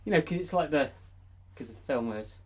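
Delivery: ordinary delivery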